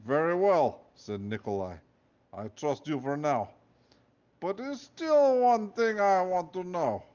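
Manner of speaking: loud, slow suspicious Russian guard; Russian accent. Acted. Nikolai suspicious.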